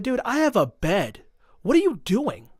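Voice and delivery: high-pitched, incredulous mock protest; retold exasperated protest. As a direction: act out pleading disbelief, pitch climbing. Acts out protest at group home.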